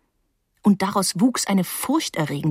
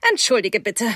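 a touch of crispness; crisp; 3 semitones higher than usual. A narrator's reading. Hostess apologises to her young guest, mildly crisp. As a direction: say it a little crisply. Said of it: "sagte sie knackig".

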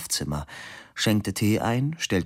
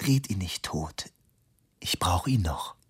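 whispered, sad aside; wistful whisper. Acted. Lonely wife whispering goodnight to her husband. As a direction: whisper it forlornly. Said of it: "betrübt und einsam".

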